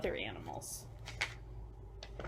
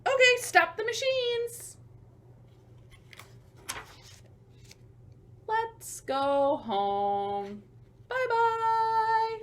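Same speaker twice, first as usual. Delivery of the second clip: higher, louder cheery call; workers calling it a day; 6 dB louder, much slower than usual. Acted. Workers ending the day, calling to each other, higher and louder. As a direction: sing out like workers heading home. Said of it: "That's probably what they're saying to each other".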